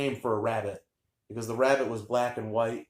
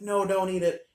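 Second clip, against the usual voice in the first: mock upset plea; 8 semitones higher than usual. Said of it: "he started crying".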